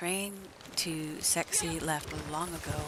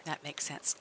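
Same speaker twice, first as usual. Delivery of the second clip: normal talking voice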